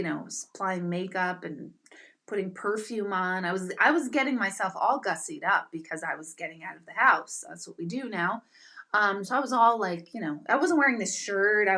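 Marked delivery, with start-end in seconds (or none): none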